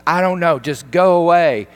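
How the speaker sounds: loud, high-pitched outburst; exasperated dismissal. Retold or imitated. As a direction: act out an upset girl driving someone off. Acted quarrel, pushing him away.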